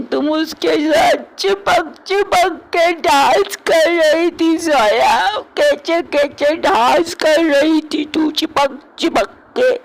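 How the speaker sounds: put-on high, slow drunken whining; sulky drunk wailing about the dance; drunken jealous whine.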